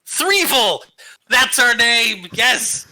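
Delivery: high, delighted shout; excited cheer. Real, not acted. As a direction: cheer it like a win. Cheering the new team name.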